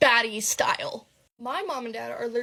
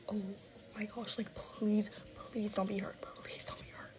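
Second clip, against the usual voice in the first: soft, near-whispered pleading, retold; acting out a hushed, fearful plea; whispered dread; 2 semitones lower, 13 dB quieter than usual. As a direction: plead under your breath, trailing to a whisper.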